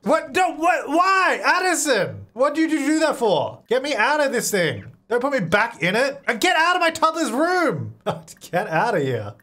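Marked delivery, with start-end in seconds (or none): 0.0-7.9 high-pitched, outraged gamer yell; exasperated yelling. Real, not acted. Player scolds Addison and intruder, high exasperated yelling.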